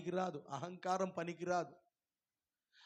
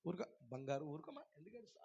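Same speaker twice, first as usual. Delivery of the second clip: much softer, lower consoling; tender soothing; 4 semitones lower, 13 dB quieter, faster than usual. Retold or imitated. God hushing his weeping child, soft. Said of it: "ఇట్లా అంటాడంట".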